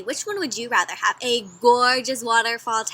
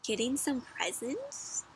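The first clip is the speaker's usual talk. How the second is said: ordinary delivery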